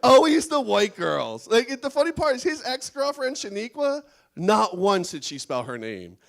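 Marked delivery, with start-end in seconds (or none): 0.0-1.5 mock exasperation.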